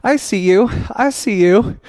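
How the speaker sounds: high, playful call of recognition; acted spotting someone. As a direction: act out suddenly spotting someone. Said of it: "And then you're like".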